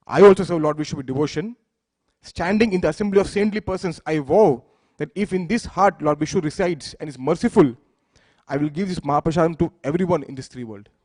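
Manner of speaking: loud, declaiming vow of a furious goddess. A narrator's reading. Parvati furiously vows before the saints to share prasadam, loud declaration. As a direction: proclaim it loudly before the assembly of saints. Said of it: "she became furious and said".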